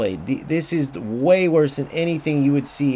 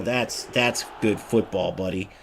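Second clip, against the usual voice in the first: mocking pro player; 7 semitones lower, slower than usual. Streamer imitating pros approving the play, low and smug. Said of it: "they're gonna look at this and go".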